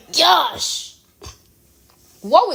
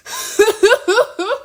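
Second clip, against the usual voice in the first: a higher burst of genuine laughter; real laugh; 9 semitones higher than usual. Real, not acted. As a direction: laugh helplessly at the screen. Laughing at bouncing states.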